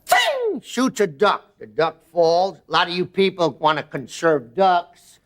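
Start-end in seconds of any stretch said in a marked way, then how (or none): 0.0-0.6 high sharp ting like a gunshot. Acted. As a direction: make the gun go ting. Gunshot sound effect in joke.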